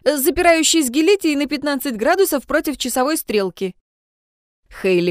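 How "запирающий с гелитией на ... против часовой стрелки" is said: commanding. A narrator's reading. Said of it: "скомандовал Людвиг".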